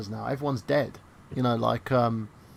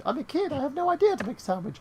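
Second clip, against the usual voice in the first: put-on much higher kid voice, faster on the excuse; whiny little kid; 13 semitones higher than usual. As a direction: plead like a clueless child. Child excuse to sister.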